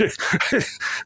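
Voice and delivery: short breathy laugh at a grim story; nervous laugh. Real, not acted. Narrator laughs awkwardly while telling a creepy story. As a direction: laugh despite the dark subject. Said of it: "I'm laughing".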